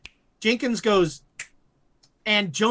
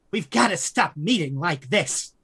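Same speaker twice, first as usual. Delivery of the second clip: thin, low creature voice; a creepy little creature voice; 4 semitones lower than usual. Acted. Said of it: "a kind of a thin, lower tone voice".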